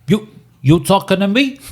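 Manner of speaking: a bad, giggly Taxi Driver tough guy; De Niro impression. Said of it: "I've gotta do a quote from the movie".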